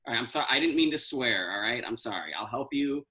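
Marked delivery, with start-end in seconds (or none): none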